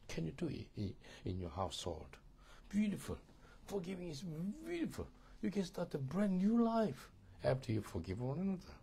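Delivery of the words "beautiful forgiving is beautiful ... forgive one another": bursting with joy over forgiveness; joyful exclaiming. Real, not acted. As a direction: lift it up with delight.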